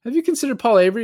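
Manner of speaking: high, rushed imitation of an eager lady; mock lady's voice. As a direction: squeak it out quickly like a keen busybody. Repeats the lady's line in voice.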